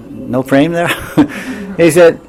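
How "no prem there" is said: "no prem there?" raised high as a query; questioning intonation. Retold or imitated. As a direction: lift "no prem there?" into a higher-pitched question. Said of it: "intonation indicates that it's a question".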